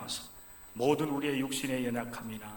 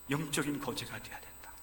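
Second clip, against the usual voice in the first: ordinary delivery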